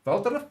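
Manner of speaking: Valdriv tried a second way, questioning rise; doubtful stab at the name Valdriv; unsure pronunciation. Real, not acted. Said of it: "I'm gonna pronounce these, these, these names like, uh, uh, like I assume".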